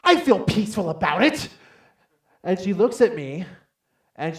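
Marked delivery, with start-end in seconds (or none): 0.0-1.5 high, loud mock-panicked outburst; hammed-up frantic squeal; freaking out. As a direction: claim calm while audibly panicking. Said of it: "freaking out".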